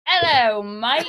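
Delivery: high, loud Australian greeting; playful Australian hello; Australian accent. Shown as a demonstration. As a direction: put on an Aussie accent and greet a mate. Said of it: "do your Australian accent".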